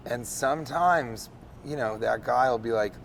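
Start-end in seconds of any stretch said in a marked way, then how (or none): none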